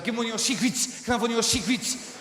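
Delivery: put-on high South African accent, vowels vanishing. Comic mimicking South Africans saying cigarettes, South African accent. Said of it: "in South Africa and this guy said".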